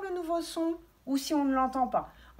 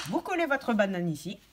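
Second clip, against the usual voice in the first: ordinary delivery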